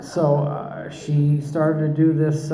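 ordinary delivery

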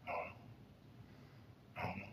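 slow, barely voiced whisper; numb whisper. Answering the girl at the funeral, a slow dazed whisper. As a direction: drags it out, breathless and lost.

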